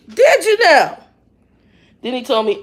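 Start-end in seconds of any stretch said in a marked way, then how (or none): 0.1-1.0 loud, high-pitched sarcastic disbelief; mock surprise. Answering the trainer who found the paperwork, loudly mock-surprised. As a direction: pitch it way up and loud, feigning amazement.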